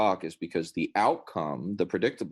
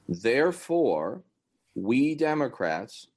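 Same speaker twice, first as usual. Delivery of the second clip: ordinary delivery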